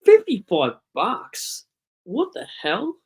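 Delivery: high-pitched disbelief, drawn out on the price; real shocked outburst at a bill; incredulous. Real, not acted. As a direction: balk at the price, voice shooting up.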